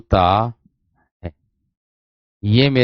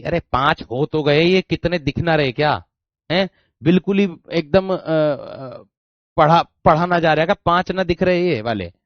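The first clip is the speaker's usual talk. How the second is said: real exasperation, pitched up; exasperated scolding; 5 semitones higher than usual. Real, not acted. Teacher to students who can't see five, exasperated scolding. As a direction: scold in a higher, incredulous voice.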